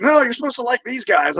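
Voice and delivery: high scolding mom voice. Retold or imitated.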